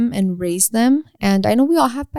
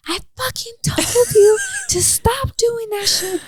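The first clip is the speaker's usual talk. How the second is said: much higher, slow, furious scolding; angry mom scolding. Retold or imitated. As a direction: scold the kid in a high, drawn-out outburst. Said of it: "you don't have to be like".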